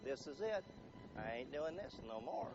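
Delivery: low, hushed declaration of quitting; muttered last straw, low and soft; quietly fed up. Acted. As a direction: say it softly, as if the decision is final. Acting out deciding to run away.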